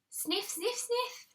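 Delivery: high, sniffy little sniffs; sniffing. A narrator's reading. Reading to kids, acting the dog sniffing.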